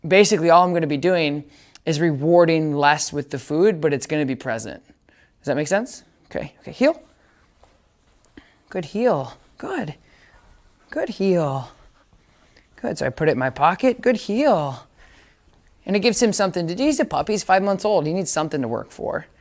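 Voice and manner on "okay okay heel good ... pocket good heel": bright trainer voice praising a puppy; dog training voice. Real, not acted.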